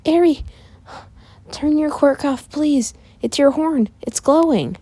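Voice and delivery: high-pitched urgent plea; pleading sister. Acted. Izuka begs Eri to stop her quirk, high and urgent.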